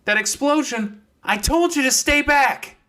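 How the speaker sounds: high loud scolding shout; angry scolding. Acted. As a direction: yell at her for not staying back. Fox scolds Clementine.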